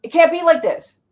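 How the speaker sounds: high, loud panicked protest; panicked manager part. Acted. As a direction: cry out like a frantic inner manager. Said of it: "Managers are like".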